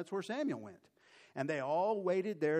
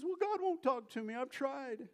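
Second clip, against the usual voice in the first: much higher, pleading excuse; Saul desperate; 10 semitones higher than usual. Acted. Saul tells Samuel God won't answer, high and pleading. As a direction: whine out the excuse.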